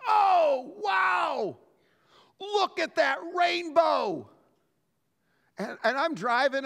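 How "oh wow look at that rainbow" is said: high, loud, delighted exclaim at a rainbow; wife spots a rainbow from the car, high and thrilled; excited wife. Retold or imitated. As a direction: gush like you just spotted something wonderful. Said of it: "all of a sudden she goes".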